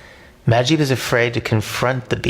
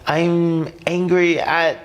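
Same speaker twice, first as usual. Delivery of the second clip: hesitant; 7 semitones higher than usual. A narrator's reading. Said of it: "Majib hesitates before saying".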